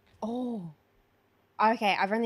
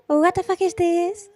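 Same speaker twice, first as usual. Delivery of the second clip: slow, higher, louder disbelief; acted movie quote; 7 semitones higher, 5 dB louder, slower than usual. Player acts a Sisters line for friend, slow disbelieving quote voice. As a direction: draw it out like a film line.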